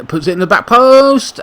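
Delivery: rising play-by-play call; excited call. Real, not acted. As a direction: sell the cross to the back post. Live match commentary chance.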